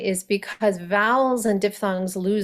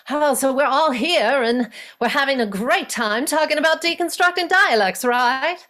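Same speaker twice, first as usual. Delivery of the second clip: put-on lofty old-Hollywood transatlantic lilt, pitched up; transatlantic accent; 6 semitones higher, 4 dB louder than usual. Shown as a demonstration. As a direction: sweep it out like a 1940s film star. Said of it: "So fun to, to imitate, isn't it? It's like".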